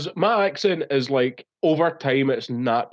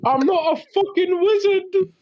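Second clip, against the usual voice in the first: laughing sobbing shout. Retold or imitated. Quoting the viral video's weeping Harry, shouting. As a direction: wail it while cracking up. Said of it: "crying while he's doing it".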